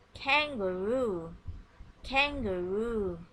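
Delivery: kangaroo stretched slowly, syllable by syllable; slow teacher modelling kangaroo; slow model word. Shown as a demonstration. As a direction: draw kangaroo out slowly for the class. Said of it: "listen and repeat after me".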